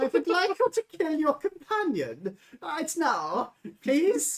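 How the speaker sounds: high, loud hammy polite request; overly sweet sinister ask; mock polite villain. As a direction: GM parodies an NPC politely asking for murder. GM mocks NPC request.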